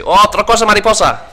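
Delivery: high, loud mock welcome; exuberant restaurant greeting. Restaurant staff greeting a regular by his catchphrase, exuberant greeting. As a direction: hail a regular with a big, high call. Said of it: "They say".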